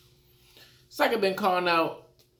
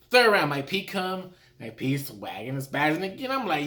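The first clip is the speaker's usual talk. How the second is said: ordinary talk, nothing marked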